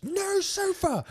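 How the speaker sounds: put-on very high, slow toddler squeak; mimicking a tiny girl's demand. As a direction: stretch it out like a stubborn two-year-old. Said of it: "And she".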